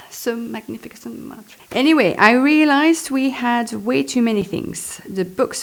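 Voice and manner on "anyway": ordinary delivery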